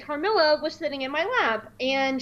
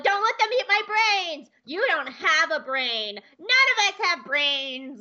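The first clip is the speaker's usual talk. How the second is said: higher, then slowing droid babble; panicky droid; 6 semitones higher than usual. Retold or imitated. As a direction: ham up a scared dim droid. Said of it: "some kind of droid line".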